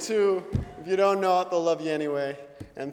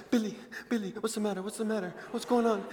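hushed, anxious questioning; soft concern; 2 semitones higher, 6 dB quieter, faster than usual. Retold or imitated. Host mom gets down beside Billy, softly concerned. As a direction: imitate a worried mother soothing him. Mom comforts crying son.

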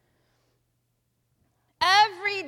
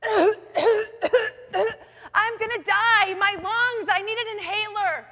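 louder, higher desperate plea; loud pleading; 4 semitones higher, 4 dB louder than usual. Retold or imitated. Coughing man at the intersection, loud and desperate. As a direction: cry out like a cold coughing man begging. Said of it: "He says very loudly over and over".

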